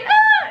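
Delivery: short high yelp like a puppy; imitated puppy squeal. Retelling the vet visit, a high puppy squeal. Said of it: "He kinda made, like, a squeal".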